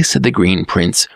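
ordinary delivery, nothing marked

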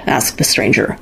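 ordinary delivery

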